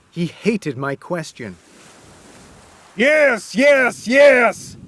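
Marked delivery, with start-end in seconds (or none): 2.9-4.8 high, loud, building shouted yes; frantic shout. Acted. Wyatt answering the narrator wildly, loud frantic shout. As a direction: scream it in madness. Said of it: "Cornelius shouted".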